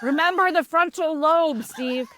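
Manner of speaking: high, loud teasing reminder; sing-song friend reminder. Retold or imitated. Friend Christina teasing the dad, high and playful. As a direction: chide him playfully like a wise friend. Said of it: "my friend Christina was like".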